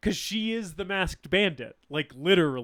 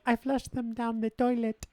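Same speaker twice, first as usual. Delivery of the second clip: put-on cute, higher-pitched Romanian child voice; little girl's Romanian accent; 6 semitones higher than usual. Quoting the kid from the movie in a cute Romanian accent. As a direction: imitate a small girl with a Romanian accent. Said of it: "in her little Romanian accent".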